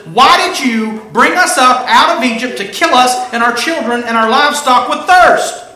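high, shouted accusation; acted outcry of an aggrieved crowd; loud grumbling. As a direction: hurl the complaint at full voice. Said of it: "the people grumbled against Moses".

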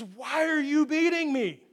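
lightly voiced donkey pleading with its master; talking donkey. Donkey asking Balaam why he beats it, lightly voiced and hurt.

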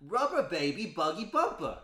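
Cockney accent. Shown as a demonstration. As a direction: shout it like a Cockney. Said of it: "Is it a Cockney".

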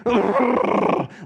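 high imitated little girl giggle; toddler giggle. Dad imitating his daughter's giggle, high. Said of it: "she would go".

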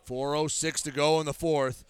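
ordinary talk, nothing marked

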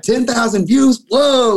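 high, loud, drawn-out astonishment; freaked-out amazement. Retold or imitated. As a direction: marvel slowly and loudly at huge news. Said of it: "we were so freaked out about it".

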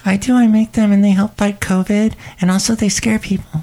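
high sing-song shop lady; Shelley Shuhart voice. Acted. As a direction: gush in a high character voice. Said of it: "It's Shelley Shuhart".

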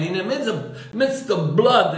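usual speaking voice

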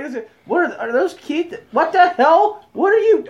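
high, confused questioning; baffled disbelief. Retold or imitated. Watching friends reacting in stunned confusion. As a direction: gape in confusion. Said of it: "We're watching like".

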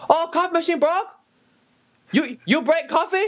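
high mock Chinese-accented English. Mandarin-speaking customer orders decaf, in a high mock Chinese accent. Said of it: "She go".

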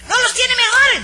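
hammed-up falsetto of a fussy customer; mocking Nananina's voice. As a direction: mimic the customer in a shrill falsetto. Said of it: "Entonces dice".

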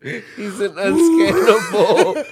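slow, high, through real laughter; laughing. Real, not acted. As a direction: crack up while landing the joke. Laughing over their own joke.

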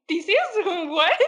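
high, laughing confused question. Retold or imitated. Student answers her thesis advisor, laughing in mock confusion. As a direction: giggle it as if clueless.

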